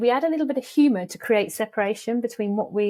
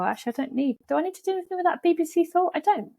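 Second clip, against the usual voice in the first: much higher, quicker, playfully dismissive; silly high voice; 10 semitones higher, faster than usual. Shown as a demonstration. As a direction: brush the thought off in a squeaky, jokey voice. Mock self-talk dismissing worrying thought.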